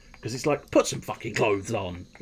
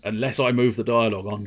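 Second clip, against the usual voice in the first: usual speaking voice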